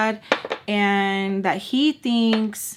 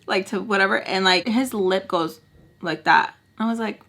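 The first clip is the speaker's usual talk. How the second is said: usual speaking voice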